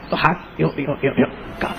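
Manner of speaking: hushed demonstration; trembling hand shown. Shown as a demonstration. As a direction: show the shaking hand quietly. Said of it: "یوں چھری رکھی".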